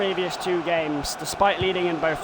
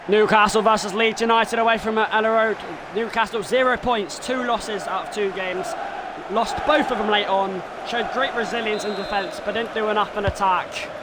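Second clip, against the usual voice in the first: match preview; 3 semitones higher than usual. Acted. Gamer previewing his own match, announcer voice. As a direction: set up the fixture like a TV presenter.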